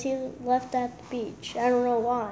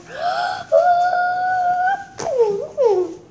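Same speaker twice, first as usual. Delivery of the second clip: very high, crying gasp from sourness; shriek-gasp at the sour taste; high squealing gasp; 4 dB louder than usual. Real, not acted. Sour candy reaction.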